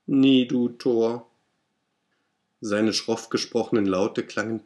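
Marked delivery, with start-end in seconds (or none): 0.1-1.3 slow, louder croaking raven voice; raven's croak. Acted. Raven answers the grieving narrator, croaking slowly. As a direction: drag out each word like the raven. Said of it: "Sprach der Rabe".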